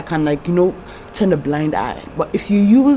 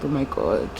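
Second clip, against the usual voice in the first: tearful; 7 semitones lower, 6 dB quieter than usual. Real, not acted. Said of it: "I'm so embarrassed".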